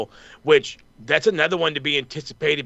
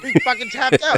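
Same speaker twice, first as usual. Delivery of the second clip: laughing; 8 semitones higher than usual. Real, not acted. Laughing over the tap-out.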